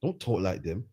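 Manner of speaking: mock inner thought, low and soft; imitated private grumble. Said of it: "there's him thinking".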